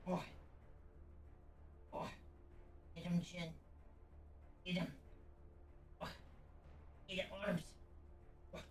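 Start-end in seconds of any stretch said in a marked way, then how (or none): none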